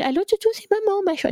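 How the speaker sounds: put-on high, sing-song call to a toddler on the phone; silly phone voice. Mother calling her toddler on the phone, high sing-song coo. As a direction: coo down the line like a fond mum. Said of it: "je suis là comme une maboule".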